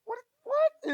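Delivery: very high sharp disbelief; a fan hearing his team is losing, shocked; startled. Retold or imitated. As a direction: act caught off guard. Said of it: "I'm like".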